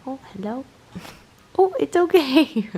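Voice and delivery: high, rising pet voice. Real, not acted. Owner soothing kitten that startled, high coo.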